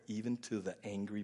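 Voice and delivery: usual speaking voice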